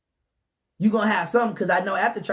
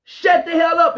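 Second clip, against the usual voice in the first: louder, higher, blunt command; shouted repeat; 7 semitones higher, 6 dB louder than usual. Real, not acted. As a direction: hit it harder the second time. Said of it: "[name] is telling you".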